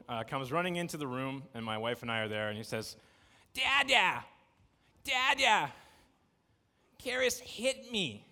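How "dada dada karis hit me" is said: put-on high, plaintive toddler voice calling his dad; whiny little boy. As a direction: play the three-year-old tattling on his sister. Little son running in, complaining.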